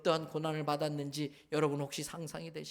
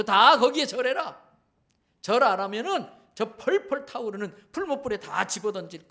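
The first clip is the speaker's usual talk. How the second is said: royal command; 3 semitones higher, 6 dB louder than usual. Acted. Preacher voicing the king ordering all to bow, loud command. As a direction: order the crowd about as a king. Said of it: "명령을 내려요".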